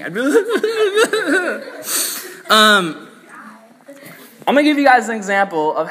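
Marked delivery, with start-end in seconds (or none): 0.2-2.3 high-pitched fake blubbering; hammed-up wordless sobbing wail; mock weeping. Preacher imagining his fright at night, high mock weeping. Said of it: "I'd probably just start weeping".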